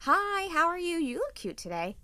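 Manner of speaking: high, sweet greeting voice; polite in-law Melissa. Retold or imitated. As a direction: play the nice daughter-in-law. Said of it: "That's the Melissa she knows".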